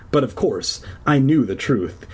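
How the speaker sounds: ordinary delivery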